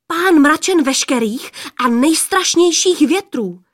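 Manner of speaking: high, loud awe at a mighty lord; awed boast. A narrator's reading. Girl warning of her mighty cloud-lord father, awed boast.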